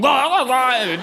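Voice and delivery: high glugging puke noise; exaggerated retching sounds; imitated child retching. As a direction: mimic a kid throwing up. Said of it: "your human child going".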